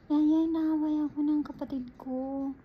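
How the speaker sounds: put-on high, loud whiny child complaining; a boy telling the nanny his sister is bullying him; child tattling. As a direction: tattle like a little kid to the nanny. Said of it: "Sabi ko naman".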